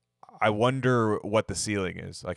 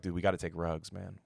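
hushed, half-whispered wired coach; imitating Bruce; 8 dB quieter than usual. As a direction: Bruce muttering back to the GM. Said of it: "And Bruce is like".

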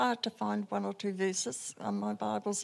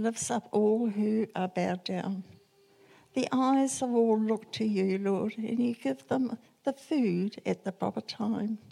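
reading the psalm aloud, a bit louder; scripture reading; 4 dB louder than usual. Acted. Sharing Psalm one forty-five with the group, a steady reading voice. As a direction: read it out as a steady public reading. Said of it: "can I read a, just a little bit?".

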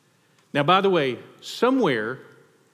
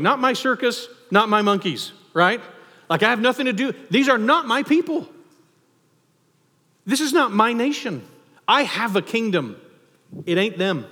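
higher, louder, insistent brush-off; emphatic dismissal; 6 semitones higher, 3 dB louder than usual. Retold or imitated. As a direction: wave it away, not my business. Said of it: "this is Jesus going".

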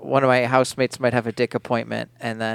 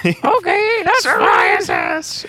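put-on Teen Girl Squad voice; 14 semitones higher, slower than usual. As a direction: shout it in a high cartoon girl voice. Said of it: "member of the Teen Girl Squad".

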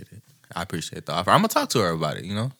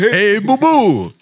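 slow, higher cartoon bear voice; Yogi Bear voice; 6 semitones higher, 4 dB louder, much slower than usual. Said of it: "that sound like Yogi Bear".

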